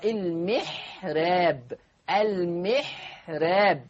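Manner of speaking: "المحراب" langsam mit hellem Ra; "المحراب" Ra dünn, nicht voll; dünnes Ra. Shown as a demonstration. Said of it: "را مش را".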